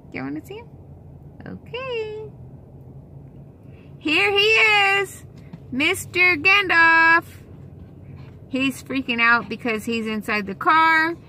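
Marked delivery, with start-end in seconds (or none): 0.1-2.3 much higher, much softer cooing. Real, not acted. Cooing before showing the dog. 4.0-7.2 much higher, louder, slow announcement; excited reveal. Real, not acted. Grand reveal of the dog.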